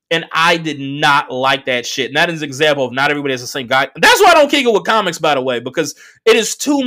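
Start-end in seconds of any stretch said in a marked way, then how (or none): none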